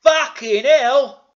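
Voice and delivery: very loud, high, drawn-out exclamation; hammed-up astonishment; shocked shout. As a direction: bellow in mock horror. [name] shocked by the result.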